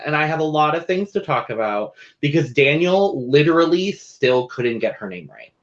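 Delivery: ordinary delivery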